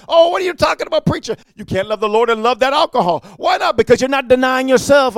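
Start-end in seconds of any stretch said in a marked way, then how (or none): 0.1-1.3 mock indignant retort. Smoker retorts to the preacher.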